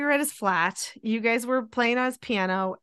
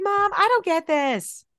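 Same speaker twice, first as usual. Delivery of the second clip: put-on whiny child; 8 semitones higher, slower than usual. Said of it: "sometimes you'd be like".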